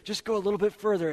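an insistent tempter pressing harder; coaxing tempter. Retold or imitated. Culture voiced as a tempter.

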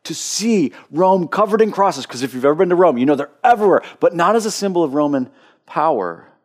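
sermon voice climbing in intensity; preaching at full force. Acted. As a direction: lift the room as you preach.

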